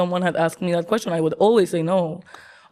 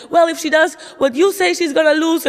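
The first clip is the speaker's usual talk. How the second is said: dad throwing the same line back, higher still; mock the dad's heated reply; dad in argument; 8 semitones higher, 4 dB louder than usual. Dad snapping back at mom over the sandwich, raised mocking voice. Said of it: "And my dad was like".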